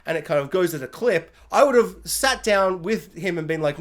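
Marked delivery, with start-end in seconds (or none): none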